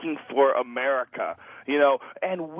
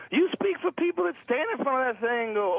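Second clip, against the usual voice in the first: retold comeback; 9 semitones higher than usual.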